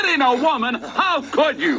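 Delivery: high-pitched outrage, slowing on the last words; shocked, indignant reproach; indignant scolding. Real, not acted. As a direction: scold him, appalled and high. Interviewer scolds wrestler for slapping woman.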